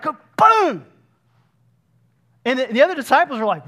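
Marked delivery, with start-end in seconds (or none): 0.0-0.9 excited outburst. Retold or imitated. Peter striking with the sword.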